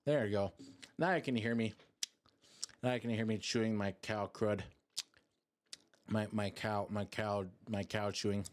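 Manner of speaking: soft close-mic ASMR voice. Real, not acted. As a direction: murmur softly, chewing gum close to the mic. ASMR gum chewing for chat.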